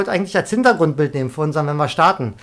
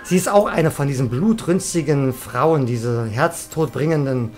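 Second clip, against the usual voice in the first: gedehnt dramatisch; 4 semitones lower, slower than usual. Pretend. Dramatische Beschreibung der Figur.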